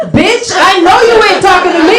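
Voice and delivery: high, loud sassy yell; sassy shout. Acted. Talking pussy loudly sasses grandma back. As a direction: shout it with full attitude. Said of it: "And then my pussy said".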